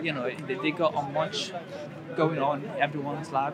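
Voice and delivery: ordinary talk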